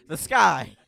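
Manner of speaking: loud exclaim; shouting. Real, not acted. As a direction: call it out loudly. Shouting answer about rain.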